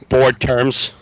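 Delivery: ordinary talk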